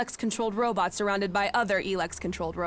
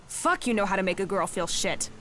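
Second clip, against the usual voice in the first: ordinary talk, nothing marked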